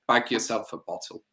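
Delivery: normal talking voice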